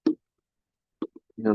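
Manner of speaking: usual speaking voice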